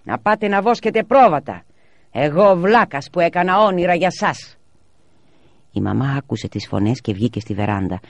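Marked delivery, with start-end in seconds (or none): 0.0-4.6 raised, high, loud scolding; father blowing up, loud and high; angry shouting. A narrator's reading. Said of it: "άρχισε να φωνάζει".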